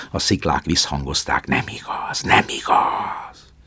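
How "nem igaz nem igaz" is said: fading, slow whispered echo. A narrator's reading. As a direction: let it die away like a cliff echo. Echo of the cliffs. (suttogó visszhang)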